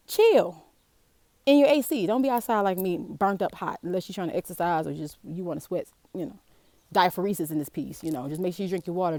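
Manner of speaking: normal talking voice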